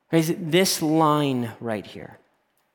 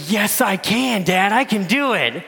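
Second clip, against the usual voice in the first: put-on eager kid; 6 semitones higher, 3 dB louder than usual. Son eager for a hundred bucks.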